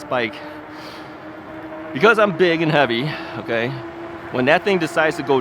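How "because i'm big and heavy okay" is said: ordinary talk, nothing marked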